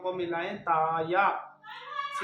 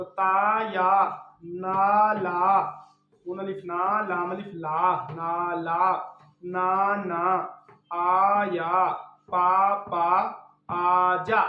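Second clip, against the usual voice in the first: louder, deliberate syllable-by-syllable reading; spelling drill; 4 dB louder, much slower than usual. Shown as a demonstration. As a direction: call out each letter clearly for a child to repeat. Teacher spells syllables aloud.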